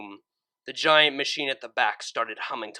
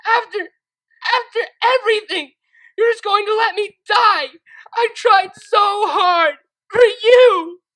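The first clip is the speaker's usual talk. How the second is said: high, loud sobbing shout; crying shout; 15 dB louder than usual. Acted. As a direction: break down crying out the last words. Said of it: "crying and shouting".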